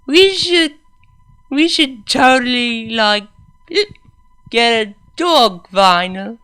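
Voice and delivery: put-on high, loud drunk mare, winded from laughing; breathless after giggling. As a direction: gasp it out after a giggling fit. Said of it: "she gasped through her breathlessness after her giggling fit".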